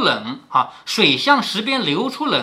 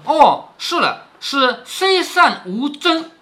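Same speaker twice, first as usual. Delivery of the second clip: slow, higher-pitched "aha" of solving a riddle; dawning realisation; 7 semitones higher, much slower than usual. Acted. Daiyu answers Li Wan's riddle, with slow dawning realisation. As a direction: draw it out as the answer clicks.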